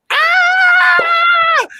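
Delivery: very high, loud burst of joy; excited squeal. Real, not acted. Singer thrilled about returning to Porgy and Bess, joyful high squeal. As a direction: let out a thrilled shriek.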